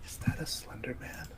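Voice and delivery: hushed, whispered question; furtive whisper. Acted. Asking Tuck about the weird guy, whispering. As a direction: ask it under your breath so the stranger won't hear.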